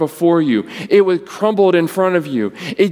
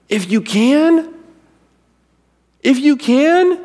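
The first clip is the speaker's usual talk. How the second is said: higher and louder each time, disbelieving; incredulous repeat; 10 semitones higher, 4 dB louder, slower than usual. Retold or imitated. Jesus echoing the father's doubt, incredulous. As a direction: echo his words back, amazed he'd doubt. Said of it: "What does he say?".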